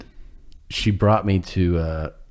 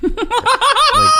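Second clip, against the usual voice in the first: laughing; 6 dB louder than usual. Real, not acted. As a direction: burst of high laughter. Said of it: "I can't believe".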